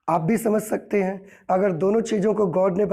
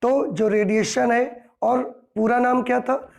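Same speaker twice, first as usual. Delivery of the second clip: ordinary talk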